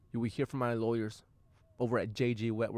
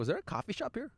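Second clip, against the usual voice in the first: timid high-voiced question to the valet lady; high sheepish ask; 11 semitones higher, 4 dB quieter than usual. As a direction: ask "Is there a coffee shop here?" in a much higher, softer voice. Retells asking the valet lady.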